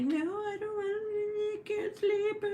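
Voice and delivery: high, drawn-out mock whine; whiny child. Mom mimics her son refusing bedtime, whiny child. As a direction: protest slowly in a high kid voice. Son's bedtime protest, imitated.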